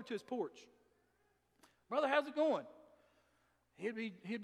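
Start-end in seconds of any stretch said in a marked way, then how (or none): 1.9-2.7 bright loud greeting, pitched up; hearty call-out. Retold or imitated. Pastor calling to the drunk man on his porch, loud and friendly. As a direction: call out to him across the porch.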